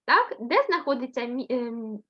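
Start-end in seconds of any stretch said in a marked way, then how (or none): none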